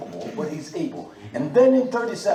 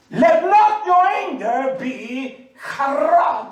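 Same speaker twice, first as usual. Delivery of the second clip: pleading Gideon; 10 semitones higher, 6 dB louder, much slower than usual. Retold or imitated. Preacher voicing Gideon's plea.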